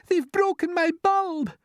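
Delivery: slow, very high lament; wailing. A narrator's reading. Glowworm complaining of her bulb, wailing. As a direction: mourn the broken bulb. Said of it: "wailed the glowworm".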